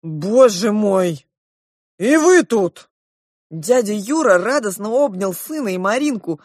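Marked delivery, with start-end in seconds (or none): 0.0-2.9 loud, drawn out, rising in pitch; astonished exclamation. A narrator's reading. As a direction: exclaim in amazement at finding family here. Father astonished to find his family.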